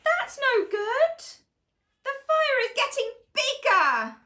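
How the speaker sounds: high, fast playful panic; mock worry. Reader warning the child about the fire, mock panic. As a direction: hurry it with pretend worry.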